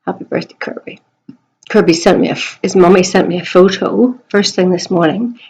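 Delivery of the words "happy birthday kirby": soft whispered birthday wish. Real, not acted. Wishing pupil Kirby happy birthday, whispered. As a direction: breathe it like a little secret.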